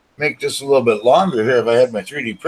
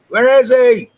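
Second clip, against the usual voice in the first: excited; 14 semitones higher, 8 dB louder than usual. Acted. Repeats it, much higher.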